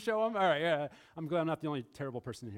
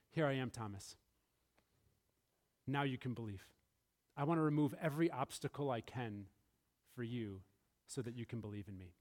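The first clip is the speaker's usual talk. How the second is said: tender reassurance; 6 semitones lower, 5 dB quieter than usual. Acted. Jesus reassuring doubting Thomas.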